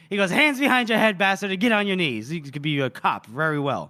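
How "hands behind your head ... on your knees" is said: higher, louder rapid-fire order; commanding. Acted. Thug robbing Shank of his ring, commanding. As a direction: order a captive down like a cop.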